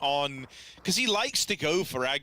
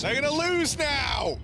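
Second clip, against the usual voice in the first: excited outburst; 11 semitones higher, 3 dB louder, slower than usual. Real, not acted. Caster reacts to round swing.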